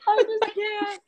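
very high, helpless squeak; crying with laughter. Retold or imitated. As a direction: imitate someone overcome by painful laughter. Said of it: "I'd be crying 'cause I'm in pain and I'm laughing".

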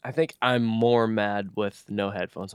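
ordinary talk, nothing marked

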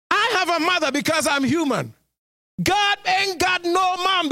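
high and pressing, climbing to a shout; pleading pulpit cry; impassioned preaching. Acted. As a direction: hammer the question at the flock. Preaching at full force.

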